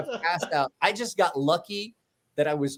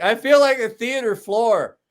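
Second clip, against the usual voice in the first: hungover laughing complaint; 5 semitones higher, 5 dB louder than usual. Retold or imitated. Hungover narrator groaning with laughter.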